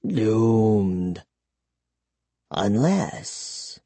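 low vampire voice, pondering; hushed vampire gloom. A narrator's reading. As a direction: murmur low, letting a thought dawn. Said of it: "he whispered".

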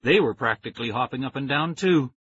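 ordinary delivery, nothing marked

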